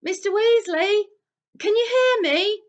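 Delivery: high, loud call through the boards; Harry calling to Mr Weasley in the chimney. A narrator's reading. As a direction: make yourself heard behind a wall. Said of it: "called through the boards".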